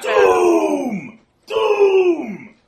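repeated high, loud, drawn-out shouts; creature's doom cry. Acted. Creature points at the player, ominous shouting. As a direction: chant the warning at full volume, each cry falling. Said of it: "he points at you and he says".

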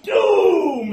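high, loud, drawn-out shout; another creature's cry. Acted. Another creature comes out of the underbrush, ominous cry. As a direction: shout it long and high as a warning. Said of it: "And another one comes out".

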